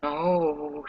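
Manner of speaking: ordinary talk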